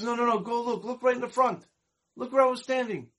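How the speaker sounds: insisting he go look; lightly acted urgent performer; eager insistence. [name] insists manager look.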